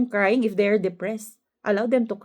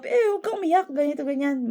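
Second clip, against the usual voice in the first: higher-pitched imitation of someone discouraging tears; lightly acted, higher-pitched telling-off; mock scolding; 5 semitones higher, faster than usual. Imitating people who tell others not to cry, mock scolding.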